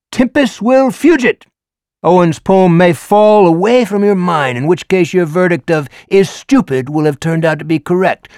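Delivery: theatrical teacher. Acted. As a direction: perform the lecture like a showman teacher. Teacher Ricker lecturing class.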